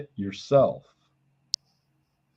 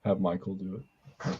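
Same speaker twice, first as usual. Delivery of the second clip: usual speaking voice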